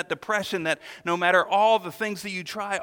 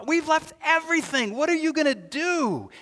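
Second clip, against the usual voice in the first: higher, pleading complaint; mock whining Peter; 8 semitones higher, 4 dB louder than usual. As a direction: play Peter as a worried, whining disciple. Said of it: "Peter's going".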